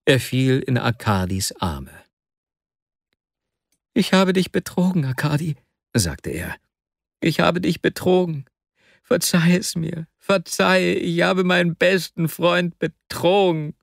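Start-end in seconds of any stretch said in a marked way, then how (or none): 3.9-5.6 higher, pleading tearful voice; tearful confession. Acted. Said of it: "Tränenfluten stürzten aus Vasjas Augen". 7.2-13.7 higher, pleading tearful voice; tearful confession. Acted. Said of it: "Tränenfluten stürzten aus Vasjas Augen".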